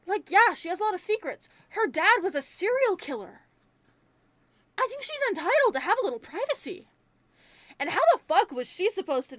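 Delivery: high-pitched, insistent pushback; indignant defense. Real, not acted. Reviewer defending Vanessa's secrets, indignant.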